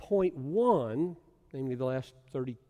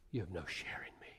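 soft grave whisper; 8 semitones lower, 12 dB quieter, faster than usual. Acted. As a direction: whisper it like a solemn warning. Hushed solemn warning in quote.

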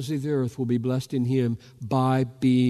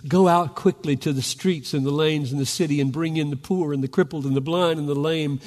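loud, high, ringing proclamation; preaching at full force; 4 semitones higher, 6 dB louder than usual. Acted. Preacher voicing the master commanding his servant, loud and high. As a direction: throw the master's command to the back row.

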